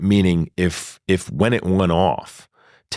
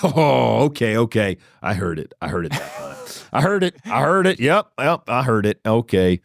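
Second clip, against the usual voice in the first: giddy excitement; 5 semitones higher than usual. Retold or imitated. Reacting to hearing God's voice.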